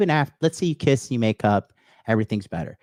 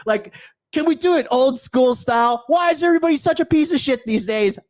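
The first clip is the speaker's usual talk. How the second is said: exasperated rant; 11 semitones higher than usual. Real, not acted. Ranting about finding decent people.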